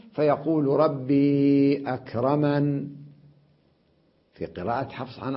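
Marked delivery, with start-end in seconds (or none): 0.7-2.9 slow recitation, أكرمن closed on n; Abu Amr's reading. Shown as a demonstration. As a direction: stretch ربي, end أكرمن without ee. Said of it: "أبو عمرو يقول". (قراءة أبي عمرو بحذف الياء)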